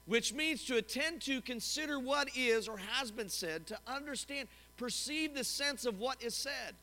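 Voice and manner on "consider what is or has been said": ordinary delivery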